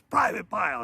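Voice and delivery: high-pitched imitation of a reprimand; lightly acted scolding call; mock sergeant's call. Sergeant leans over Private Pyle, mock reprimand.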